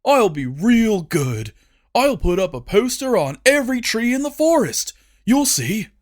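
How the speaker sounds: high, eager fake obedience; overly sweet fake compliance; falsely compliant. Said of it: "his falsely compliant voice".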